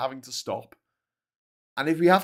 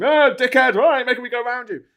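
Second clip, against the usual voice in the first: high, loud mock outburst at another driver; hammed-up angry driver yelling; road rage; 12 semitones higher, 9 dB louder than usual. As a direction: blow up in exaggerated road rage. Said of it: "really angry in the car".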